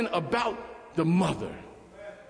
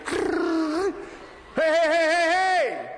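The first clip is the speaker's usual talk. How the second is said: gushing chatter; 6 semitones higher, much slower than usual. Acted. Wife gushing about her day.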